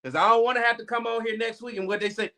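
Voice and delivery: usual speaking voice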